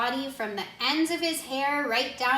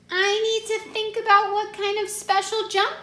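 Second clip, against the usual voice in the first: put-on much higher, eager child voice; high child excuse; 11 semitones higher, 5 dB louder than usual. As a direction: say it high and quick like a stalling kid. Jabari making an excuse.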